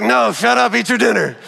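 put-on high, loud joking snap at the kid; mock snap. Dad snapping at son Ike who caught him grumbling, loud mock snap. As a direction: bark it back, half kidding.

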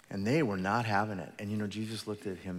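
ordinary delivery

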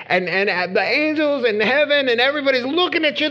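high, loud sermon at full force; impassioned preaching. Real, not acted. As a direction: press the plea urgently, pitched high and loud.

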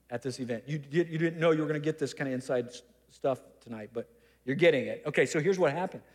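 usual speaking voice

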